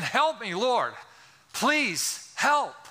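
high, urgent appeal; pleading. Acted. Sinner falling at Jesus' feet, pleading. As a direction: beg earnestly, pitch lifted. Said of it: "falling at his feet and saying".

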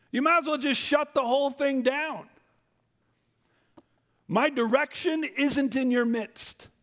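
preaching at full force. Real, not acted. Preacher voicing God's lament.

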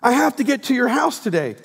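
high, loud, insistent invitation; eager Jesus. Pretend. Preacher voicing Jesus inviting himself to the house, eager. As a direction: press it like you won't take no. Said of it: "Jesus goes".

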